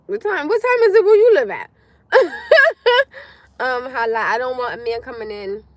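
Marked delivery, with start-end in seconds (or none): 0.1-1.7 high, loud disbelieving cry of surprise; shocked she's hours behind; surprised outburst. Real, not acted. Reacting to a viewer's time zone, loud and surprised. 2.1-3.2 high burst of laughter; loud laugh. Real, not acted. Laughing at a viewer's comment, loud high laugh.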